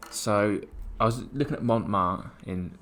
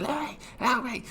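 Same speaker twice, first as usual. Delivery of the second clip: much higher, slow, mock frightened call for help; imitating Tash's plea; slower than usual. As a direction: squeak out a mock panicked plea. Girlfriend's plea voiced.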